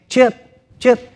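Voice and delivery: put-on calling a lost child's name; a parent calling out in a store. Parent finding lost child, calling his name. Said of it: "one of your parents' voice".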